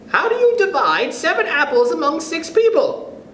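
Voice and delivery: high, bright quiz voice; teacher's question voice. Acted. Teacher quizzing the class in a high voice. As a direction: pose it like a teacher.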